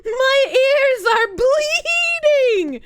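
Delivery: high, drawn-out mock wail; mock agony. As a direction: wail it loudly, stretching the words.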